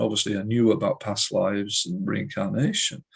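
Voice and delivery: ordinary talk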